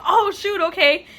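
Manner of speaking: high, loud imitation of taken-aback staff; retold startle.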